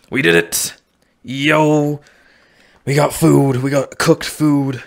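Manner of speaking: elated, drawn-out exclaiming; triumphant cheer. Real, not acted. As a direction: cheer a small victory with gusto. Celebrates finally cooking food.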